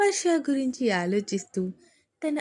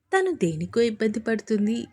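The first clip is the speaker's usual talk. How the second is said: normal talking voice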